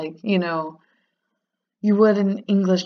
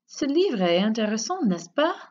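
high pitch, N'EST-CE PAS swooping up; tag question; 4 semitones higher than usual. Shown as a demonstration. Teacher models second French example, tag question rising on N'EST-CE PAS. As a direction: check for agreement, lifting N'EST-CE PAS high. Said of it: "you just add n'est-ce pas".

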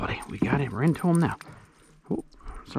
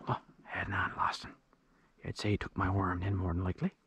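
hushed aside; 5 semitones lower, 12 dB quieter than usual. Real, not acted. Muttering to himself after losing fish.

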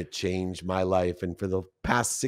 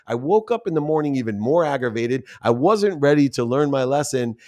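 ordinary talk

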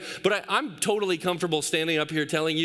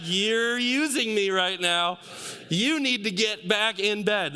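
slow, drawn-out dad telling-off; retold firm parent; 4 semitones higher, slower than usual. Dad to son stalling at bedtime, slow mock scolding. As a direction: lay down the law slowly, as a retold scene. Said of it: "I was like".

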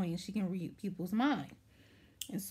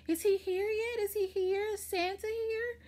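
put-on much higher, childlike eager voice; excited little girl; 13 semitones higher than usual. Granddaughter calling down about Santa, high and eager like a child. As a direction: play an eager little kid waiting for Santa.

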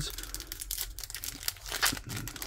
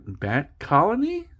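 Bat Colony rising high at the end; question-mark ending; 7 semitones higher than usual. Shown as a demonstration. Joking that every card name is a question, Bat Colony with upward lilt. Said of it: "Everything ends with a question mark".

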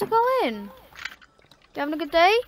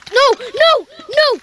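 repeated high, loud cries; yelled dismay; 9 semitones higher, 6 dB louder, slower than usual. Real, not acted. Player sees the game going wrong, yelling in dismay. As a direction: yell in rising panic.

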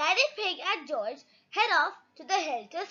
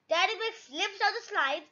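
plain reading voice

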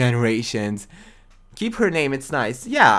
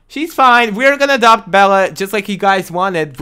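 much higher and louder, bursting out; excited announcement; 6 semitones higher, 9 dB louder than usual. Real, not acted. Streamer telling chat she adopts Bella, high and loud.

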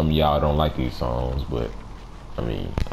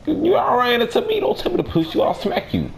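put-on high squeaky bratty kid. Voicing game kid, squeaky.

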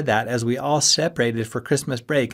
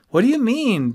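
high, loud, wounded question; hurt; 13 semitones higher, 6 dB louder than usual. A narrator's reading. Friend stung by a put-down, hurt and high. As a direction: protest it, pitched way up. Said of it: "obviously hurt".

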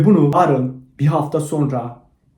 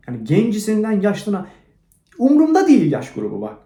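heated rant; 6 semitones higher than usual. Real, not acted. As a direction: rail at them, voice climbing high. Heated rant about women.

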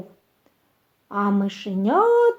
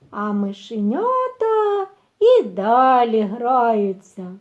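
usual speaking voice

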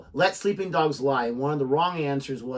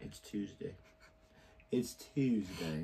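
soft, understated mock British accent; badly faked British accent, very quiet; deadpan attempt at a British accent; terrible British accent; 5 semitones lower, 13 dB quieter, slower than usual. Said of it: "ignore [name]'s terrible, uh, accent there".

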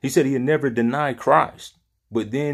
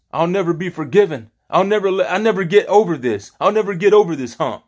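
raised, emphatic voice of giving up; acted despair; 6 semitones higher, 3 dB louder than usual. Preacher imagining Peter giving up like Judas, acted despair. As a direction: preach it as someone lamenting, higher in pitch.

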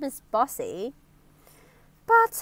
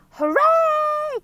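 loud, very high drawn-out cheer; 7 dB louder than usual. Acted. Crowd applauding Mister Brave, loud cheering. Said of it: "cheered the crowd".

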